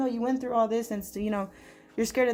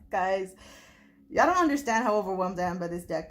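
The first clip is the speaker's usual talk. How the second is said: ordinary talk, nothing marked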